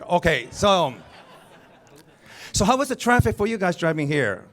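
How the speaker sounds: normal talking voice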